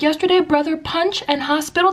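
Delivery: high, simplified questioning; high teacher-to-tot voice; teacher checking with child. Retold or imitated. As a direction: echo a toddler's words back as a question. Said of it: "I'd be like".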